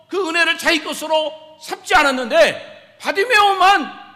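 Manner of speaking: loud, high sermon crescendo; impassioned preaching. Real, not acted. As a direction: drive the rhetorical questions home forcefully. Preaches at full force.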